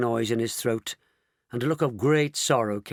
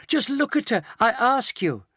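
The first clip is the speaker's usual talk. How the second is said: high-pitched dismay; crying out; 12 semitones higher, 4 dB louder than usual. A narrator's reading. Grandpa Joe appalled at his wife, crying out. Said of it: "cried Grandpa Joe".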